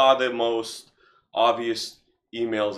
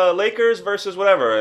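communist chairman; 10 semitones higher, 9 dB louder than usual. Pretend. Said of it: "Imagine being this communist, oligarch, chairman person".

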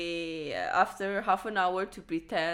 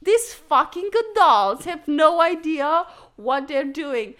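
loud, higher frustrated rant; exasperated kid; 6 semitones higher, 10 dB louder than usual. Retold or imitated. Child fumes about the adults, loud and exasperated. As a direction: vent at clueless grown-ups. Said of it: "and I was like".

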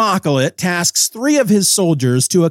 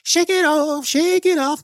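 much higher, loose silly voice; high squeaky shake-off; 12 semitones higher than usual. Real, not acted. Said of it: "Let's shake it off".

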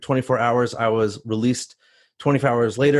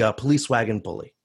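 usual speaking voice

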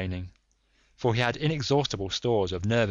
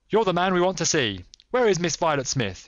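raised, crisp questioning; quick, clear demand; 8 semitones higher, 4 dB louder than usual. A narrator's reading. Holmes confronts the armed cyclist, quick and clear. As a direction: demand an answer, high and forceful. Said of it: "in his quick, clear way".

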